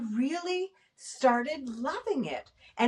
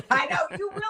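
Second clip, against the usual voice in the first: laughing as the words come out; 6 semitones higher than usual. Real, not acted. Replying to someone who likes the book idea, laughing. Laughing at another person's joking remark.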